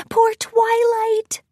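very high, slow, tender pity; meek pony cooing in sympathy; pitying Fluttershy. A narrator's reading. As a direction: draw it out high and sorry. Shy pony pities her friend.

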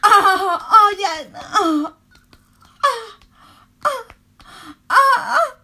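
high, loud moaning cries; climax cries. Acted. Lover at climax, crying out high and loud.

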